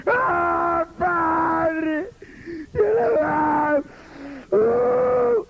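high crying wail of an overdone prayer; over-the-top tearful "padre" cry; mock wailing prayer. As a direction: ham up a pastor's theatrical sobbing prayer. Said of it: "¿Han visto esas oraciones?".

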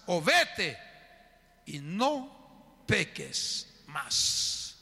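very slow, measured pronouncement; solemn pardon. Acted. Preacher voices Jesus pardoning the accused woman, slow and solemn. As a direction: draw each phrase out with long pauses.

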